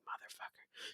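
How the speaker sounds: barely audible whispered curse; cursing under breath. Retold or imitated. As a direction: whisper it to yourself, retold. Cursing privately as car is called.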